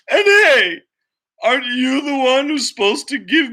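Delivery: pony scolding the farmer through mock sobs; hurt, whiny pony. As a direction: play the wounded pony, half crying, half laughing.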